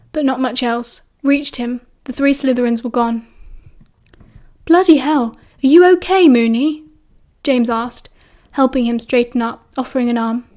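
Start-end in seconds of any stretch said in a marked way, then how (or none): 4.7-6.8 alarmed concern. A narrator's reading. James finds his friend hurt.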